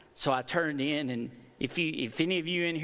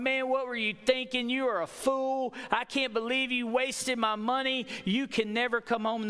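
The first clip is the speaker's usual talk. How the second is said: mock yell; 7 semitones higher than usual. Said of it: "He didn't yell at him going".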